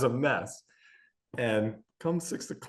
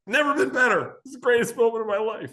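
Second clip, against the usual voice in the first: higher and louder, over-bright fake enthusiasm; forced cheer while in pain; mock cheerful; 11 semitones higher, 4 dB louder, faster than usual. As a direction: pretend you're fine while hurting. Hurting dad faking cheer.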